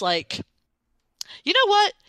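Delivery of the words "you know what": sudden high, loud exasperated burst; frustrated outburst. Retold or imitated. Player fed up with stalling hostages, bursts out high and loud. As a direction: snap out of frustration at the stalled rescue. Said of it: "I'm just frustrated".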